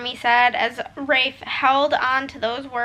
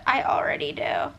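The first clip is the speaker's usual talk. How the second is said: tearful whisper. Real, not acted. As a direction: whisper through tears. Emotional reading.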